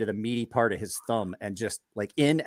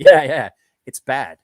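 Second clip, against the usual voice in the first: mock Russian accent; 12 dB louder than usual. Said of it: "Bastion's French accent needed to be Russian".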